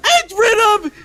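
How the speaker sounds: hype call. Real, not acted. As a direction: draw out the big moment. Announcing a game win.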